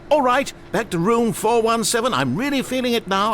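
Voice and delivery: high-pitched, eager urging; growing excitement. Acted. Narrator urging the player back to the room, excited.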